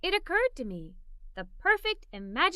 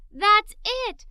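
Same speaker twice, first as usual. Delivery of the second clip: higher, louder eureka cry; excited; 7 semitones higher, 7 dB louder than usual. Acted. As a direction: shout it out as the idea lands. Child finds perfect friend idea.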